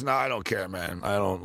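low, trailing-off mock indifference; indifferent. Host retells a player brushing off his MVP award, indifferent. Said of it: "he really had zero".

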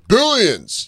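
high, swooping call; fan's shout. Retold or imitated. Fans spotting him in the street, calling out. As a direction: shout the show title in recognition.

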